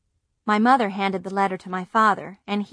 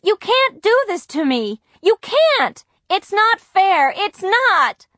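very high, loud desperate protest; crying out; 12 semitones higher, 8 dB louder, slower than usual. A narrator's reading. Girl protests loudly to her mother. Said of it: "I cried".

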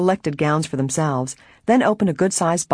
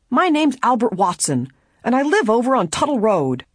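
higher-pitched, aggrieved man; protesting prisoner; 8 semitones higher than usual. A narrator's reading. Prisoner gives the detective name and address, defensive. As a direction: answer as the defensive suspect, pitched up.

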